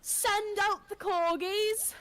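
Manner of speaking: high, slow, drawn-out announcement; hammed-up summons; mock royal command. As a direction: call it out grandly, slowly. Mock British command in joking run.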